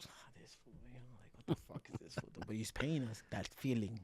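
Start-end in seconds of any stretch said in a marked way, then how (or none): 0.1-2.4 muttered aside. Retold or imitated. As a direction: whisper it like a sneer you don't want heard. Coach mutters about odd style.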